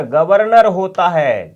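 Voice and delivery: ordinary talk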